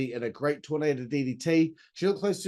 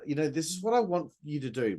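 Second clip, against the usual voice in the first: ordinary talk, nothing marked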